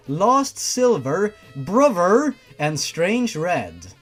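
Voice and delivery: ordinary talk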